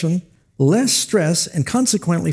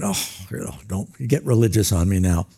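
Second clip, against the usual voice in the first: cynical reporter; 8 semitones lower, 3 dB quieter than usual. Retold or imitated. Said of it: "some cynical atheist reporter".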